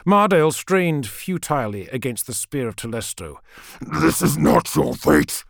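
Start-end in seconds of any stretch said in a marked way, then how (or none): 3.8-5.4 slow, harsh demon voice; drawn-out demonic fury; raging demon. A narrator's reading. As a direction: rage it out as a monstrous creature. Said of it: "it raged".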